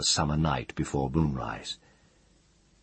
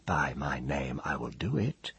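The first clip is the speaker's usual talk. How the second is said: hushed, near-whispered oath; quiet vow; 7 dB quieter than usual. A narrator's reading. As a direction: swear it under your breath. Ged swears a grim vow.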